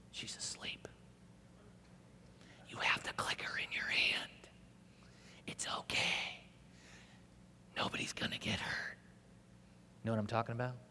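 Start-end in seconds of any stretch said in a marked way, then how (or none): 0.1-9.0 soft, breathy tempting whisper. Retold or imitated. The old Kenny tempting him at night, whispered. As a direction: whisper it like a seducer in the ear. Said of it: "the whisper says".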